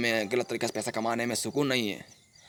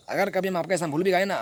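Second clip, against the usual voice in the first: normal talking voice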